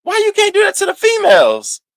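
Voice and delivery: mocking falsetto quip; mocking high voice. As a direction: toss it out in a high, put-on voice. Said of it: "and I'm just like".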